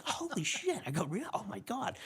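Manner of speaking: high, stunned mock disbelief; shocked. Cop retells his stunned reaction to the lady's news, high and disbelieving. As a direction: gasp it out like you can't believe it.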